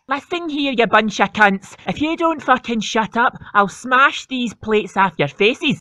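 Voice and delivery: low, gruff mum threatening the family; angry mum. Acted. Mum threatening her rowdy family, low gruff voice. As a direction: growl a warning as mum.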